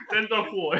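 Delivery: lightly mocking Neapolitan street accent; cuozzato Neapolitan Italian. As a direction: say it like a Neapolitan tough guy. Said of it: "è un italiano un po' più cuozzato".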